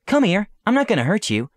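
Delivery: high, gentle coaxing; coaxing princess. Acted. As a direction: coax a frightened animal closer. Coaxing the scared creature.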